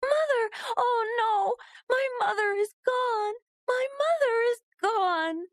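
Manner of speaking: very high crying wail; girl crying over her dead mother. Acted. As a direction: sob it out in shock. Uba finds her mother dead.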